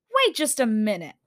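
firm, protesting hold-on; indignant interruption. A narrator's reading. Girl halting the creature's dismissal, indignant. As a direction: stop the creature in its tracks.

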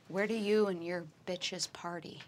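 intimidating whisper into her ear; menacing whisper. Acted. Player intimidating a captive with whispered terror. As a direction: breathe it close and threatening. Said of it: "and I say / whispers of terror".

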